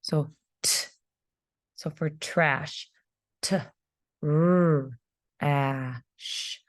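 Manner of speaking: "Trash" then split into "t", "r", "ash", each sound said apart; teacher sounding out a word for first graders. Shown as a demonstration. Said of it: "our digraph is actually at the end".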